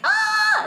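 very high, loud mock shriek; mock high scream. Snake sliding into the scientist's arm, very high loud mock shriek.

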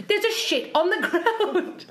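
high, slow, drawn-out squeal; reenacted horror, high and laboured; horrified exclamation. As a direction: imitate a friend's appalled announcement, stretched out. Acting out friend's horrified answer.

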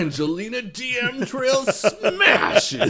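slow, loud, high hype announcer; drag brunch ad. Acted. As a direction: ham it up with laughing hype.

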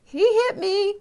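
put-on high, loud child's shriek; mock tearful scream at mommy. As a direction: shriek it like a hurt little girl.

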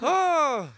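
loud, high honking inhaled laugh; Jimmy Carr laugh. Acted. Host mimics an affirming comedian, with loud high laughs. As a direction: imitate a comedian's gasping laugh, full force. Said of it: "it's like he's in the room".

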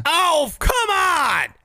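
mock protest. Real, not acted.

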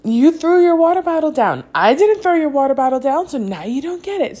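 high, loud and fast angry telling-off; mother scolding her son in the park. Retold or imitated. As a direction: snap back at the kid, fed up. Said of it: "I'm like".

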